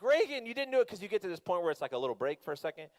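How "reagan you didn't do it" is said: exclaiming protest. Retold or imitated. Dad protesting to daughter mid-ride.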